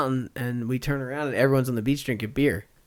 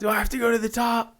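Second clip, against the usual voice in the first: high, pleading retold voice; high-pitched mock question; 9 semitones higher than usual. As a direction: imitate a friend balking, pitched right up. Acts out scared friend's question.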